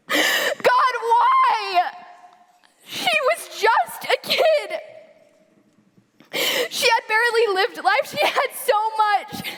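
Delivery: high, anguished crying out; tearful anguish to God; tragic heroine sorrow. Acted.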